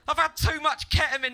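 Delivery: high, panicked mock scream; loud screaming. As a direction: scream it like a wrecked raver. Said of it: "Really loud screaming".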